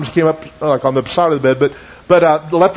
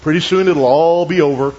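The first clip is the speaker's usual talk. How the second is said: professional preacher voice; 4 semitones lower than usual. Retold or imitated. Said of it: "he put on his professional voice".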